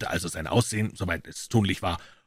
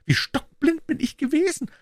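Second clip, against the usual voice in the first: shocked exclamation. A narrator's reading. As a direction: burst out in sudden realization. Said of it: "rief unser Klient".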